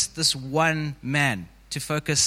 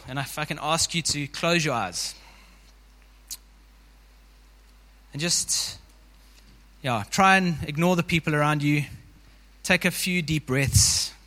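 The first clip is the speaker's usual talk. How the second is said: guided meditation. Acted. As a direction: ease the congregation into the scene.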